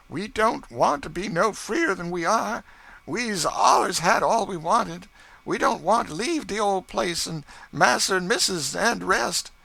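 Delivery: high, loud earnest begging; anxious to keep their old home; pleading servants' voice. Acted. Freed servants beg young master George to stay, high pleading voice.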